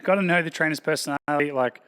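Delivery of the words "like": ordinary talk, nothing marked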